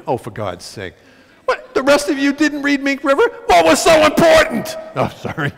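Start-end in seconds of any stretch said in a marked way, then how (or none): 1.5-4.8 high, loud mock outrage at the audience; mock yelling. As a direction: pretend to scold the crowd, hammed up. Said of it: "you cannot yell at people".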